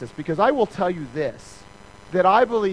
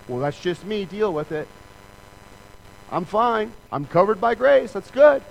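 defiant dismissal; 3 semitones higher, 4 dB louder than usual. Pretend. Proud person dismissing the criticism.

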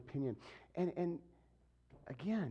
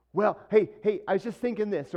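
loud, higher, eager interrupter; mock someone barging into the talk; butting in; 7 semitones higher, 11 dB louder than usual. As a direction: pipe up loudly over everyone. Acts out people butting in.

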